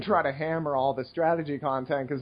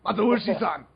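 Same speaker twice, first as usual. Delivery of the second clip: higher-pitched imitation Japanese accent; mock Japanese accent; 4 semitones higher than usual. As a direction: put on a playful Japanese teacher's accent. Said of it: "do it in the accent my teachers".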